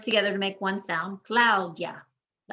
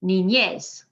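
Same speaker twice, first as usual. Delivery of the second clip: "Niñez" stressed ni-NYES; last-syllable stress; 4 dB louder than usual. Shown as a demonstration. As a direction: land on the final syllable of "Niñez". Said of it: "That's on the last syllable".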